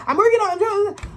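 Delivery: high, fast, loud protest; defensive Scorpio. Pretend. Reader voicing the Scorpio's effort, high, loud and fast. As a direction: blurt it out like you're trying.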